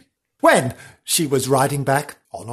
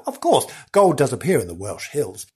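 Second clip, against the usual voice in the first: ordinary delivery, nothing marked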